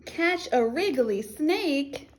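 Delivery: put-on slow, stretched words for children; slow, expressive read-aloud; animated storybook reading. A narrator's reading. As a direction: read it big, drawing out each action. Reads action book to children.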